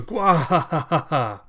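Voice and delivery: slow, hammed-up evil cackle; villain cackle. Cyborg villain gloating over torn-apart Alita, evil cackle. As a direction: gloat with a big monster laugh. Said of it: "you hear him going".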